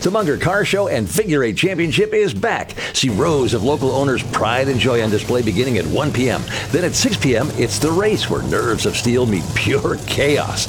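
punchy, rising promo voice; hype ad read. Acted. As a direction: sell the race night with energy. Reads a hype race ad.

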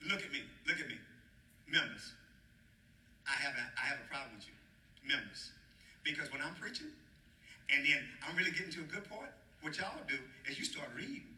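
mock-stern word to the flock; mock scolding. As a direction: drop low and soft, confronting them in jest. Pastor mock-scolds the congregation.

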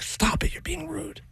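hushed whisper scold. Retold or imitated. Wife hushing him at the dinner table. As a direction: hiss it under your breath. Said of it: "My wife's like".

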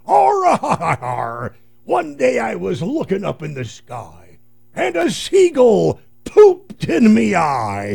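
high loud slow pirate, hearty laugh. Retold or imitated. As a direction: boom it out like a pirate. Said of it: "You said".

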